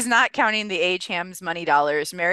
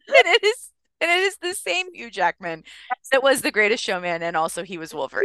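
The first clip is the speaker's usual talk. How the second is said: can't hold it together while reading; breaking character giggling; 2 semitones higher than usual. Real, not acted. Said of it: "I can't keep a straight face".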